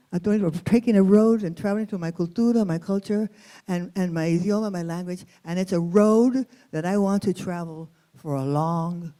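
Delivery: plain reading voice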